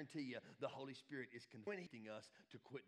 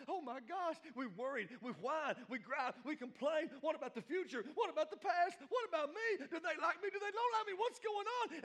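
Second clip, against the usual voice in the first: high, loud, escalating fretting; hammed-up whining panic that keeps climbing; mock frantic worry; 13 semitones higher, 9 dB louder than usual. Mimicking drama-addicted worriers.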